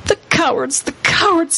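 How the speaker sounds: put-on half-sobbed, upset girl; sobbing. A narrator's reading. Susan weeping as the rabble binds Aslan, sobbing. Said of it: "sobbed Susan".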